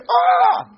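loud, very high comic scream; mock scream. Preacher imitating people hitting forty and fifty, mock scream. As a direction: yell out in hammed-up horror.

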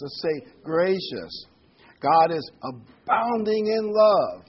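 loud, slow lead lines, each word held for the crowd to echo; call and response. Shown as a demonstration. Pastor leading congregation to repeat words, loud and slow. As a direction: preach it out and wait for them to answer. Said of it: "Would you all repeat these words with me".

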